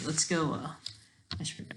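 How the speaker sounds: ordinary delivery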